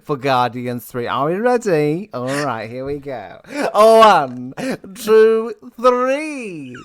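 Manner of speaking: loud, drawn-out primary teacher rallying the class; teachery. Pretend. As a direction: lead the whole class in a big countdown. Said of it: "I feel like we're going teachery now".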